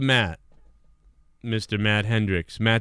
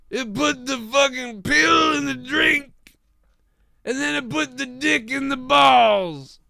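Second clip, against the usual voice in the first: much higher, slow hammed-up shout; mock reading; 13 semitones higher, slower than usual. Streamer reading a viewer's super chat aloud, hammed-up shout. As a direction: belt out the viewer's message absurdly.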